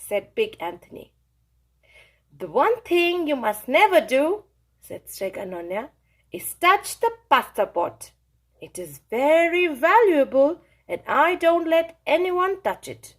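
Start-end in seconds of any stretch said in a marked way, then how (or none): 2.4-13.1 higher, louder stern grandmother voice; bossy Italian grandma laying out the rules; old witch giving orders. Acted. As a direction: lay down the chores like a strict old witch. Strega Nonna warning.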